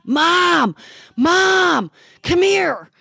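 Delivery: loud, high call into the house. Retold or imitated. Brother calls his mother to the door, loud and high. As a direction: shout for mom from the doorway.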